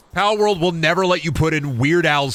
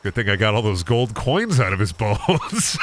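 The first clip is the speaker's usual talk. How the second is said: normal talking voice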